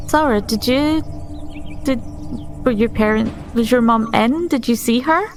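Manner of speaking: ordinary delivery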